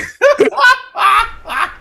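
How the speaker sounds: squealing laugh; high burst of laughter. Real, not acted. Laughing at the southern joke, squealing laughter. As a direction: lose it laughing.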